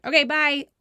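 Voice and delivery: high, loud send-off; brisk dismissal. Retold or imitated. Wife sending husband home with the baby, dismissive.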